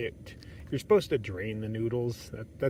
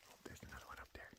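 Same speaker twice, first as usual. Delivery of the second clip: quick whisper on spotting an animal; hushed whisper; 22 dB quieter, much faster than usual. Real, not acted. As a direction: whisper the sighting under your breath. Spots another deer, keeps hushed.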